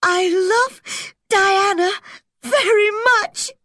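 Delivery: put-on high, slow, tearful child's plea; sobbing. A narrator's reading. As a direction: sob it out like a heartbroken girl. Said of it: "sobbed Anne".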